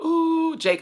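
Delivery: high delighted squeal at a friend arriving. Real, not acted. Vlogger greets arriving friend Jake, high delighted squeal. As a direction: squeal with joy as he walks in.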